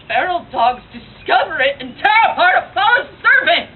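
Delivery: high, loud tragic outcry; frenzied Cassandra. Acted. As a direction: erupt at full stage volume over her own doom. Monologue that is done by Cassandra.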